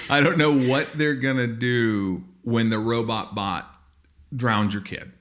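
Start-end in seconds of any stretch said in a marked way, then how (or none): none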